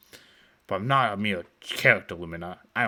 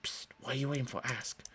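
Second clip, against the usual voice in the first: hushed, softer urgent whisper; 4 semitones higher, 7 dB quieter than usual. Acted. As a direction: hiss it under your breath. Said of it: "I whisper to him".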